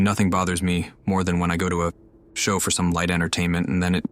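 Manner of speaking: ordinary talk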